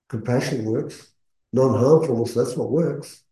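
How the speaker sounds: normal talking voice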